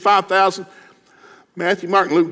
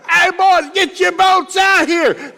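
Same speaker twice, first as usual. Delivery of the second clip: much higher, loud slow shout; calling out; 7 dB louder, much slower than usual. Acted. Peter yelling to his partners for more boats, loud slow shout. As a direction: holler across the water for help.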